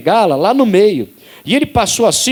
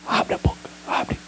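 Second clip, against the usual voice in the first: breathy whisper, softer; whispered command; 11 dB quieter than usual. Retold or imitated. Jesus whispering to the deaf man to open his mouth. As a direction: whisper it like a secret healing command.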